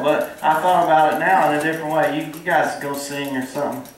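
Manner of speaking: normal talking voice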